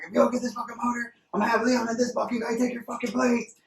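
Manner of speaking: fast, high mock boss threat; angry boss. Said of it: "he's like".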